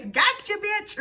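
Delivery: lightly voiced comic gotcha; Dave Chappelle voice. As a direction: snap it out like a gleeful stand-up. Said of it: "in my Dave Chappelle voice".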